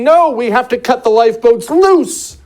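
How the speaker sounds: loud, high-pitched urgent plea; acted-out alarmed protest; urgent objection. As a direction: call out a desperate warning from the deck. Voicing passenger pleading on deck.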